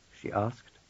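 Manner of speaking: plain reading voice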